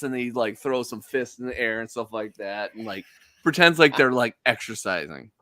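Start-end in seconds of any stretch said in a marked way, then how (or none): none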